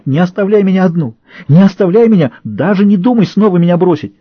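high, loud desperate begging; panicked pleading. A narrator's reading. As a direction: plead in terror not to be left. Patient lunges, begging not be left.

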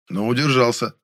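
plain reading voice

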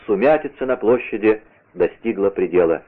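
plain reading voice

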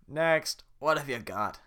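loud barking guard. Acted. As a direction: call the next one. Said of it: "The head guard's voice keeps changing".

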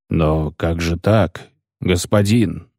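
a hint of a baffled executioner; taken aback. A narrator's reading. Executioner dismayed that the poet refuses, taken aback. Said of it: "опешил палач".

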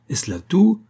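put-on high, questioning old lady; incredulous old mother. A narrator's reading. Mother challenging her son's answer, incredulous.

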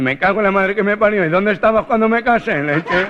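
high, loud exasperated yell; the nasal man yells at his guardian angel; mock furious nasal man. Gangoso furious shout.

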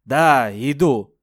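loud slow call back. Acted. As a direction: answer the call loudly. Answering George's call.

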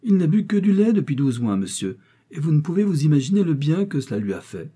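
high, insistent mother's pleading; exclaimed protest. A narrator's reading. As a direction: protest loudly on the boy's behalf. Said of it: "s'écria Mrs Heathcote".

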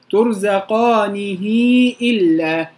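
slow recited ترزقانه إلا; recited reading. Shown as a demonstration. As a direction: chant ترزقانه إلا with the ha drawn out. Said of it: "الباقين".

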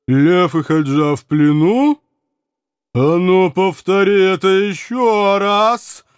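loud, high, threatening demand; menacing giantess. A narrator's reading. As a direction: boom out a threat.

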